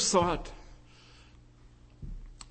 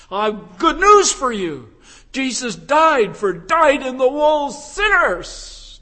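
higher, louder, urgent pulpit call; fervent preaching; 6 semitones higher, 6 dB louder than usual. Acted. Preacher urging sinners to come to Christ, fervent. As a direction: plead with the congregation to believe.

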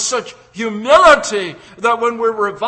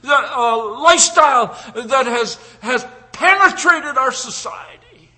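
loud, high, driving sermon delivery; full-force pulpit preaching; fiery preaching; 4 semitones higher, 5 dB louder than usual. Acted. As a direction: preach it hard to the congregation.

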